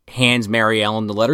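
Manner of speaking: normal talking voice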